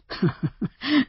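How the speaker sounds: short high chuckle; a brief acted laugh. A narrator's reading. New owner laughing at the surprise, short chuckle. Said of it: "He laughed".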